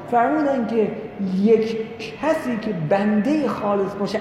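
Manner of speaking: ordinary talk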